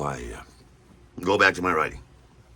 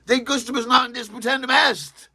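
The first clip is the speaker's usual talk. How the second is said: much higher, eager repeat of "de gustibus non disputandum est"; delighted echo; 3 dB louder than usual. Retold or imitated. Answering Deirdre's Latin quote, delighted and high. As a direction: echo her Latin with glee.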